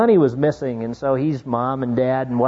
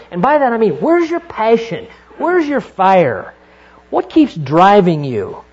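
impassioned preaching; 3 semitones higher, 4 dB louder than usual. Real, not acted. Preaching climax.

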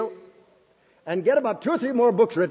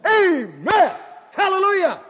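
high-pitched jubilant cry; exultant praise; 11 semitones higher than usual. Real, not acted. Preacher praising God before his congregation, exultant. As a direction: exclaim it high, full of real fervour.

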